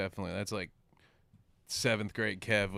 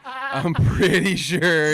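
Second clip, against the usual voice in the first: higher, louder, very slow sing-song; exaggerated mock warning; 9 semitones higher, 10 dB louder, much slower than usual. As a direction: stretch every word in a teasing singsong. Teasing mock warning repeated.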